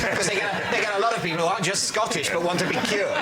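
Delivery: ordinary talk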